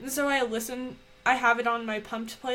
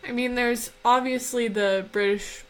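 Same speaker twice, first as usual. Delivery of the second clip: ordinary talk, nothing marked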